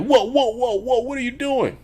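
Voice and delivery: high, panicked mock plea at gunpoint; hammed-up scared thug; startled whoa. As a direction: back off from the pistol in a high voice. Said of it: "And he's like".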